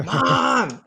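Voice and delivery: drawn-out mock kid's holler; child calling out. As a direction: call out insistently from a distance. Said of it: "I can hear it in the distance".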